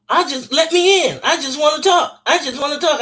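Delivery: begging. Retold or imitated. Will begging to come in.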